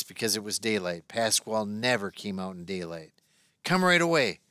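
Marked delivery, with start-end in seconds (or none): 3.6-4.4 1 urgent call. A narrator's reading. Pasquale runs up urgent.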